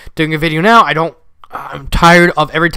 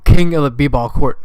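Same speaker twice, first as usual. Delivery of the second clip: ordinary delivery, nothing marked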